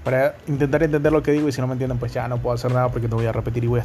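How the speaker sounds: rushed talking. Real, not acted. Podcast host rushing through his intro at full speed. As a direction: rattle it off very fast. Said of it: "Hoy ando embalado".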